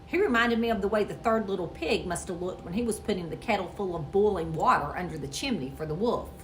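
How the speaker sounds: ordinary delivery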